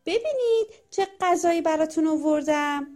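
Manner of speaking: gleeful storybook villain showing off a catch; happy announcement. A narrator's reading. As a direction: proudly call the chicks to look. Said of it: "با خوشحالی به لونش رسید".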